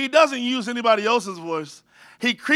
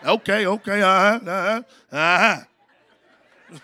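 lightly voiced cool Denzel; a hint of Denzel, laid-back; Denzel impression; 2 semitones lower, slower than usual. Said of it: "in the voice of Denzel".

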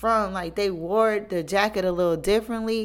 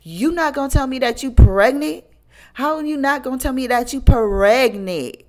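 louder, higher hurt accusation; indignant accusation; 7 semitones higher, 8 dB louder than usual. Retold or imitated. Hurt friend confronts the pregnant girl, loud and indignant. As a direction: demand an answer, betrayed and loud.

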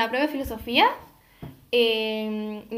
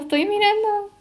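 high, louder defensive protest; mock defensive; 9 semitones higher, 5 dB louder than usual. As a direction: insist you're only looking. Defending herself to shop staff.

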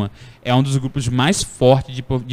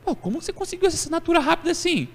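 much higher, fast amazed question; amazed coworker; 4 dB quieter, faster than usual. Retold or imitated. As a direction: coworkers stunned by the quick signature. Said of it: "aí você chega na área". (colega espantado, voz aguda e rápida)

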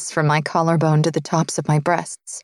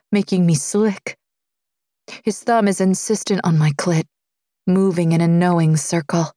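plain reading voice

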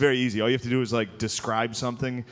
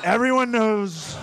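slow, high put-on Trump; Trump impression; 8 semitones higher, 3 dB louder, slower than usual. Comic ending the story, slow high Trump voice. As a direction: drawl it smugly like Trump.

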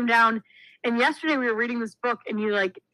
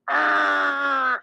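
louder shouted "Argh!" growl; toddler's growl again; 4 semitones higher, 5 dB louder than usual. Retold or imitated. Babysitter imitating the toddler growling before the button, shouted "Argh!". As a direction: bark the growl out like a proud toddler. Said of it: "she'd go".

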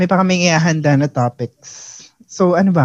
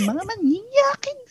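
high, drawn-out mock squeal; mock outcry; 5 dB quieter, slower than usual. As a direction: stretch the accusation out high without much volume. Acting out calling out gropers. (kunwaring tili, mataas at pahaba)

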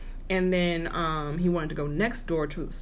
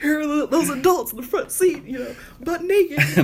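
much higher, louder, laughing through it; amused, high and loud; laughing mock scene; 10 semitones higher, 5 dB louder than usual. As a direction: paint the absurd picture while giggling. Imagines onlookers, laughing.

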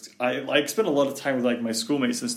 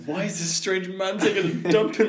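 higher, louder, laughing outraged question; owner's mock outrage; 8 semitones higher, 5 dB louder than usual. Friend voices the owner finding a stranger inside, laughing mock outrage. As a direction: play the shocked homeowner, half laughing. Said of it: "is like".

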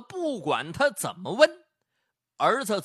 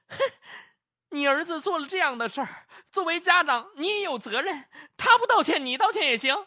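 higher, louder aggrieved demand; aggrieved protest; 7 semitones higher, 4 dB louder than usual. Acted. Shop assistant demands the mother apologize, aggrieved and rising. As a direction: press for the apology, wronged and upset. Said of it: "更觉得委屈了".

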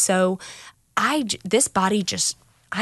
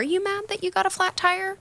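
much higher-pitched, mock-incredulous challenge; incredulous questioning; 13 semitones higher than usual. Sponsor questions a sponsee's anger over small things, incredulous. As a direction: question it in a high, disbelieving voice.

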